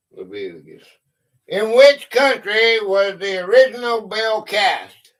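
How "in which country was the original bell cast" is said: higher, louder quizmaster voice; quiz question read-out. Real, not acted. Host puts a history question to players, loud quiz read-out. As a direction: put the question to all the players.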